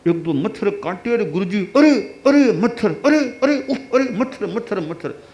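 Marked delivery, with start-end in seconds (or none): 1.1-5.2 high, flustered yelps; fussing at mosquitoes. Acted. Guru flustered by mosquito bites, high fussy cries. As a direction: swat and fuss, rising higher.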